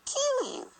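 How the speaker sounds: playful falsetto; high squeaky mock voice. Thinking to the possum in a squeaky falsetto.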